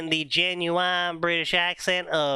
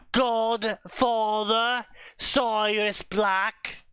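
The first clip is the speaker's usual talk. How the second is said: slow, deliberate official reading of a family register; solemn slow reading; 3 semitones higher, much slower than usual. Acted. Reading the fanfic's inheritance test aloud in a slow mock-official voice. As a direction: read it like a goblin clerk announcing an inheritance.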